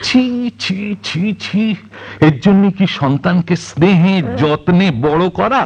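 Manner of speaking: high, drawn-out cries of shame; appalled reproach. Acted. Retired father to wife about their children, appalled.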